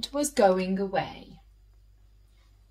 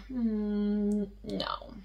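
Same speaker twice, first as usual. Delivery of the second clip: soft unvoiced no to herself; quietly deciding against it; whispered no; 8 dB quieter than usual. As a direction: whisper the decision. Jenny deciding silently.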